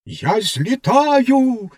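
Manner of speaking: high, slow, stretched exultant call; the rocket proudly crying out as it ignites; triumphant cry. A narrator's reading. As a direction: announce your own lift-off grandly. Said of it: "вигукнула вона".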